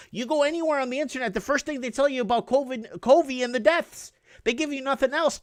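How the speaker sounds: ordinary talk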